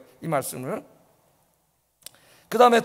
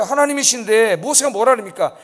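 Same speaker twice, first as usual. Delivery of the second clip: normal talking voice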